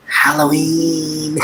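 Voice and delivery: drawn-out, high-pitched glee; gleeful relish. Real, not acted. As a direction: stretch it out long and high with delight. Gleeful mention of favorite holiday.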